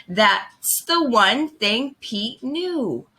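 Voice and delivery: ordinary delivery, nothing marked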